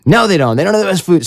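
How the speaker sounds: high-pitched, imitated angry contradiction; retold indignation, pitch jumping up; mock indignant. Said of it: "he's angry about that".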